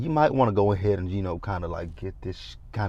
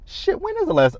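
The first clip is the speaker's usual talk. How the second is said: sudden realization. Real, not acted.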